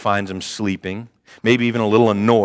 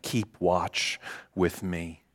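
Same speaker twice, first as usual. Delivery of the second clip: soft slow quote; 6 semitones lower, 11 dB quieter, much slower than usual. Acted. Quoting command softly.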